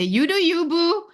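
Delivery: put-on high sassy you-go-girl line; sassy cheer. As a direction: toss it off like cheering a friend on. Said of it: "And I sometimes say".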